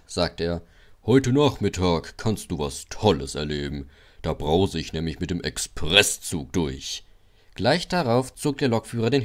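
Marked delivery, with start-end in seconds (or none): 1.1-7.0 low, condescending big-engine voice; deep, self-important voice; big proud engine. Acted. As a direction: talk down to the little one. Big proud Gordon boasting.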